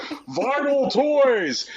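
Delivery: high, drawn-out parody cheer; mock hype shout. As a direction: stretch it out in mock excitement. Mocking presenters' repeated product phrase.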